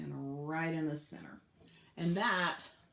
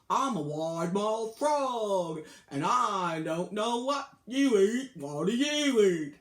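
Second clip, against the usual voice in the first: loud, slow, cartoonish frog voice, calling out; a big-mouthed frog asking, loud and slow; curious frog; 3 semitones higher, 11 dB louder, slower than usual. Frog asks the alligator what it eats, loud slow cartoon voice.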